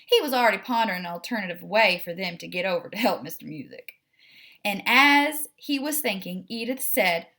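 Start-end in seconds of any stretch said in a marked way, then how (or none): none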